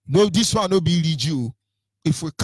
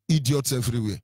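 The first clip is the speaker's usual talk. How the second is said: usual speaking voice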